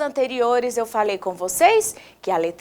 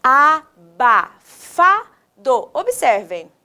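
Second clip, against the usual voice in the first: "Abafado" in four separate beats; slow teacher's breakdown of "Abafado"; syllable by syllable; slower than usual. Shown as a demonstration. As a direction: chop "Abafado" slowly into syllables. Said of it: "Vamos falar pausadamente?".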